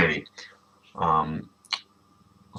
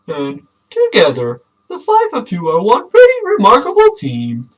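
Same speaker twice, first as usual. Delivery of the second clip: raised announcing dad voice; high, proud Clem; 13 semitones higher, 6 dB louder than usual. Acted. Father Clem addressing his five kids, proudly raised. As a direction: make a big proud speech.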